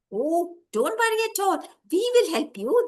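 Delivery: high, eager little helper voice; reassuring insect. Acted. Insects promise the lost boy help, high reassuring voice.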